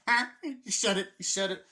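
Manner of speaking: high, loud cartoon dad whooping; cartoon dad shouting that the title was said; Peter Griffin. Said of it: "so Peter Griffin would be like".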